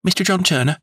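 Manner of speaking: high announcing call; a waiter announcing a guest, pitched high; waiter's cry. A narrator's reading. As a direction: call the visitor's name out high across the room. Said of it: "cried the hotel waiter".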